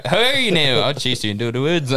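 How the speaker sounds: high, hammy taunt; mock Irish pursuer. Acting out chasing Irishman.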